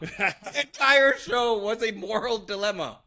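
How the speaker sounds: high-pitched mock outrage; teasing exasperation. Real, not acted. Host ribbing a friend over endless dilemmas, mock-exasperated.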